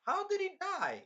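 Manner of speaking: very high, squeaky mock astonishment; baffled disbelief. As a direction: ask it way up high, imitating shock. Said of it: "and they're like".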